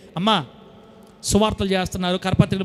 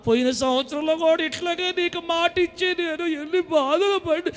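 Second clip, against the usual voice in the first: whiny believer; 8 semitones higher, 5 dB quieter than usual. Retold or imitated. Mimics a complaining believer.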